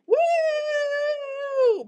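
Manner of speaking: high, loud mock cheer; mock woo. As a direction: squeal a mocking woo.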